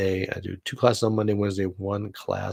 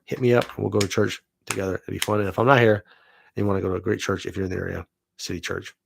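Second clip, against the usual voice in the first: usual speaking voice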